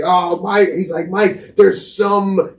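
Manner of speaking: put-on high, drawn-out teasing kid voice; laughing friend. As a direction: stretch it out high like a giggly friend.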